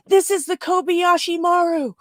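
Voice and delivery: high-pitched quoted distress call; distressed ship's caller. Retold or imitated. Fan quoting first person to say it, high urgent call. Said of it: "the first person to say it".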